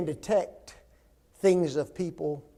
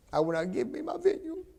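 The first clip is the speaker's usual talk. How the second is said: put-on higher, whiny sulking child voice; mock-pitiful pout, high and soft; pouting king; 9 semitones higher, 7 dB quieter than usual. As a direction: sulk it out like a spoiled little king. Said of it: "You poor little sad king".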